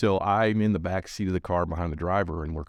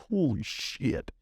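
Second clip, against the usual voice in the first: stunned; 7 semitones higher, much slower than usual. Retold or imitated. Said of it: "their faces just fucking drop".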